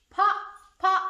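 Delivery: normal talking voice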